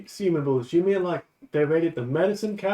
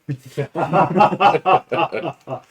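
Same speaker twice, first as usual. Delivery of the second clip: loud laugh; 3 semitones higher, 7 dB louder than usual. Real, not acted. Player laughs at accent joke.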